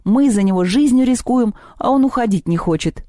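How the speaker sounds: affronted complaint; indignant. A narrator's reading. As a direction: protest at his cheek. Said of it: "возмутился Антон".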